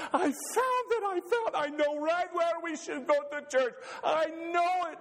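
very high, excited, half-laughing; bursting with his discovery; excited Ole. Acted. Ole telling Lena he found the church, excited and high. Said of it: "She says".

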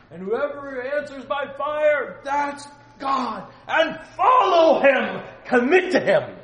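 loud, high, slow proclamation; a prophet thundering at the crowd; bellowing prophet. Acted. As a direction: bellow it out from the mountaintop. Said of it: "he's bellowing this out".

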